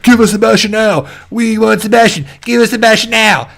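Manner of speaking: high-pitched imitation of a clamoring crowd; put-on high crowd voice; mock mob demand. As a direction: chant it like a hammed-up angry mob. Mimicking an angry Commonwealth crowd's demand.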